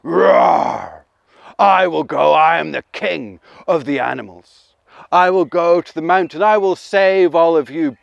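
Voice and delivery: loud, boastful lion voice; loud lion proclaiming; proud lion. Acted. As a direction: roar it out like the king of the animals. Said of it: "He let out a roar".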